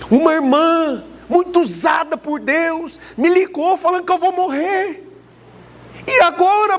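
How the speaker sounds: woman terrified after hearing she will die; imitating a panicked woman. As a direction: play her rising panic over the prophecy. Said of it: "chorando, aos prantos".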